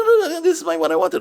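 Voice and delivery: put-on high, loud, laughing kid voice; thrilled child. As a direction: gush like a child with a present. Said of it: "And then be like".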